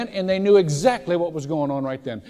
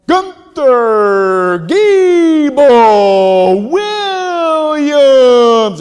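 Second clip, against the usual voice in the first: long booming ringmaster call, high and loud; circus announcer; 9 semitones higher, 14 dB louder, much slower than usual. Retold or imitated. Pastor imitating the circus ringmaster, booming drawn-out call. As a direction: stretch the name out like a big-top announcer. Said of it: "the guy would go".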